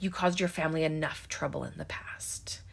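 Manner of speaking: hushed, disapproving whisper; retelling a sheriff's stern warning in a whisper; cold whisper. Retold or imitated. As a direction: hiss the reproach under your breath. Judy scolding Sam, whispered quote.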